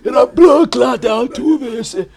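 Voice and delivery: put-on comic Jamaican accent of an aging ex-player; old Jamaican man voice. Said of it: "the old Jamaican man voice".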